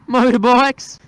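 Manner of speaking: high, loud excited shout. Real, not acted. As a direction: burst out with it. Excited repeat of motorbikes.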